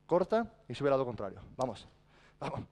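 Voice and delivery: normal talking voice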